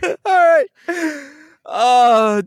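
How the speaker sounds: laughing fit. Real, not acted. Laughing fit, high crying sound.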